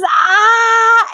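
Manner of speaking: high mock scream, held out; drawn-out, high "ah" in imitation; imitated scream. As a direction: squeal like an overexcited teenager. Imitates the girl's happy scream.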